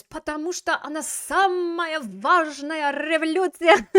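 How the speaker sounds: loud, high, drawn-out declaration; hammed-up revolutionary fervour, high and loud; bold all-caps proclamation. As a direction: proclaim it slowly as if in bold capitals. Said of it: "bold caps, and he says".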